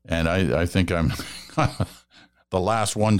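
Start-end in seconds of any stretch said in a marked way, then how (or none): 1.2-1.8 laugh. Real, not acted. Laughing at own stubbornness.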